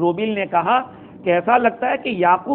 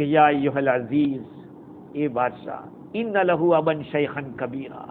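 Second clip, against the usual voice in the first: Quranic recitation; 4 semitones lower, 4 dB quieter than usual. Acted. Quran verse quoted in sermon.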